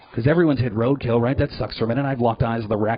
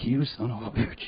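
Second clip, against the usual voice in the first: soft, slow grudging curse; muttering monkey; 8 dB quieter than usual. Pretend. Monkey in the mirror, soft slow curse. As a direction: curse under your breath like an angry monkey.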